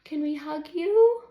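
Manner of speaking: put-on high, loud childlike question; high loud child's worried question; little girl asking. A narrator's reading. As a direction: ask like a worried small child. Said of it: "asks little Ginger D".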